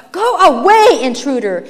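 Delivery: loud, high, then dropping; forceful yell from a hostile crowd; shouted demand. A narrator's reading. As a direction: order the intruder off. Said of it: "they demanded".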